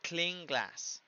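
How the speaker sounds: "Clean glass" joined, N sliding into G; assimilated N. Shown as a demonstration. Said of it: "Y lo mismo acá". ("Clean glass" unido, suena "cling-glass")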